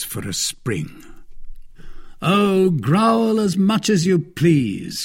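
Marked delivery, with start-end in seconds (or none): 2.2-5.0 higher, slow taunt; mocking cry. Lord de Winter jeering at the cornered Milady, higher. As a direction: jeer at her snarling. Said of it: "cried Lord de Winter".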